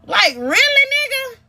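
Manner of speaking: high, disbelieving jab; incredulous. Retold or imitated. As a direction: toss it back in disbelief. Incredulous retort to caller.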